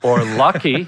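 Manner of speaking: ordinary talk, nothing marked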